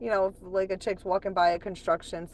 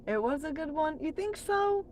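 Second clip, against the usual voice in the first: much higher, rising questioning voice; surprised question; 12 semitones higher than usual. Real, not acted. Streamer questions a viewer's compliment, high surprised question. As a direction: lift it right up in doubtful surprise.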